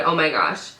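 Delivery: ordinary talk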